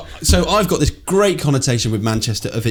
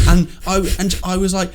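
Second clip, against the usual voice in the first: usual speaking voice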